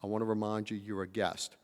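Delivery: soft, quiet, composed answer; calm reply. Retold or imitated. Conference chair answers the ranting guest, soft and calm. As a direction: answer the attack with quiet patience. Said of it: "he says".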